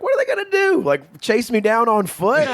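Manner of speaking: high scoffing question, slowing down; mocking dare. Friend retelling the car prank, scoffing. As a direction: scoff at the idea of being chased. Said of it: "I was like".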